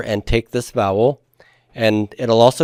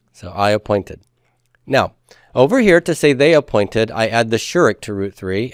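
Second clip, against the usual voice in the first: ordinary talk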